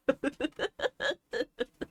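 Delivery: a loud burst of real laughter. Real, not acted. Recalling her boyfriend's face: loud real laugh. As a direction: laugh out loud and let it fall off.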